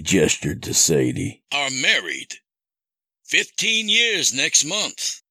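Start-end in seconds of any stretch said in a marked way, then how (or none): 1.5-5.2 high, measured villain voice; accusing captor. Acted. Captor reveals Sadie's marriage, accusing. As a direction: lay out the betrayal as the captor. Kidnapper's voice in narrated story.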